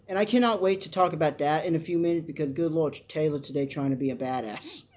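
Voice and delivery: ordinary delivery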